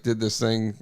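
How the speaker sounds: usual speaking voice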